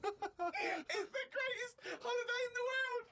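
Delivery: high, slow words squeezed through tears of laughter; laughing helplessly. Real, not acted. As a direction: barely get it out for laughing.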